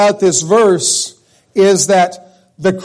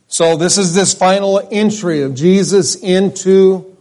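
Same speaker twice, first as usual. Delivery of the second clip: usual speaking voice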